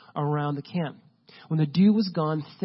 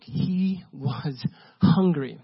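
"He was hungry" drawn out very slowly; slow it right down and land on "hungry"; slow emphasis; much slower than usual. Shown as a demonstration. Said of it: "really illustrate this real quick".

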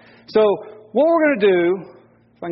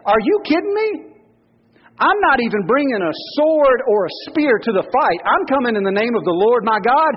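put-on cocky young David; 4 semitones higher than usual. Pastor acting David taunting the giant, high and cocky. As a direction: taunt like a fearless thirteen-year-old.

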